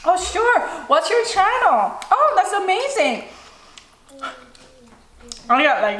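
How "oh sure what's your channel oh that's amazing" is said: much higher, bright mock enthusiasm; imagined eager host. The welcome she wished the restaurant gave, higher and excited. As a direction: gush like a thrilled host would. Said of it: "I wanted to be like".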